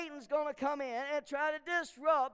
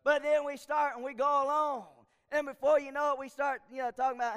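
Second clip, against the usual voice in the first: ordinary talk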